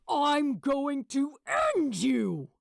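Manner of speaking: snarling threat. A narrator's reading. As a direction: spit the threat out slowly. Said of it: "Gabriel snarled at him".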